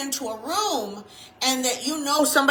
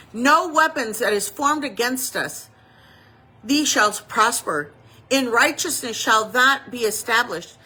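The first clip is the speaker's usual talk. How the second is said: louder, preaching the verses; scripture recitation; 7 dB louder than usual. Acted. Preacher proclaiming scripture to the congregation, louder and preaching. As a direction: proclaim it as promise.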